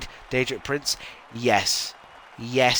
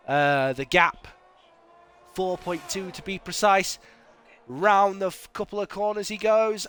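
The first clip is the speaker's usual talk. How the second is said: race commentary; 3 semitones higher than usual. Acted.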